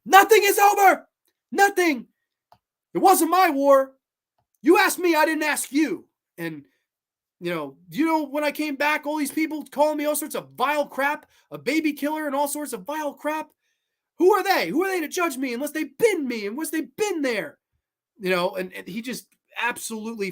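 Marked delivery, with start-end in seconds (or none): 0.1-6.1 much higher, louder desperate outburst; anguished shout. Retold or imitated. Rambo yelling back at his colonel, high and anguished. 7.9-17.6 anguished shout. Retold or imitated. Rambo yelling back at his colonel, high and anguished. Acted Rambo breakdown speech.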